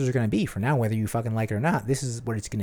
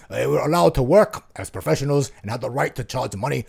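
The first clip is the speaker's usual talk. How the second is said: put-on movie villain German accent; 4 dB louder than usual. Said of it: "I'm going to do a German accent".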